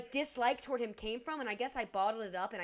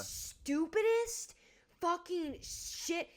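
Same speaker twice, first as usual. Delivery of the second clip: exasperated drawl; 5 semitones higher, much slower than usual. Real, not acted. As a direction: stretch stupidest out, high and slow, in disbelief. Ranting about Matt Rife's comment.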